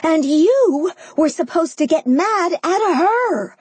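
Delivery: high, indignant outburst; angry accusation. A narrator's reading. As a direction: turn on her in outrage. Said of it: "I whirled on Sophia".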